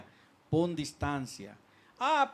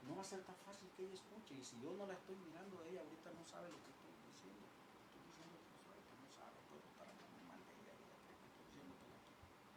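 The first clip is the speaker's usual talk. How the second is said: very faint, distant voice; quick talk lost in the distance; off the microphone; 29 dB quieter, faster than usual. Shown as a demonstration. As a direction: speak away from the mic, barely audible. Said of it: "si yo quito el micrófono".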